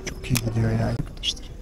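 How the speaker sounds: hushed whisper. Real, not acted. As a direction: whisper so the fish stay calm. Whispering while fishing quietly.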